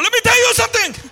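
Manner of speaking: high, loud and fired up; excited Peter. Retold or imitated. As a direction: burst in like you can't hold it. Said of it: "Peter gets so excited".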